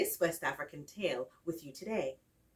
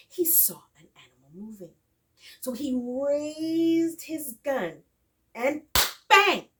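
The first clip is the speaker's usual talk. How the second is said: ordinary delivery, nothing marked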